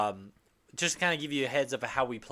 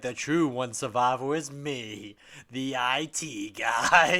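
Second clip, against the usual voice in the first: slow, drawn-out villain declaration; hammed-up slow proclamation; mock triumphant reveal; 4 dB louder, slower than usual. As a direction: announce yourself grandly, stretching the last words. Mimics Kelly's sitcom-style ending line.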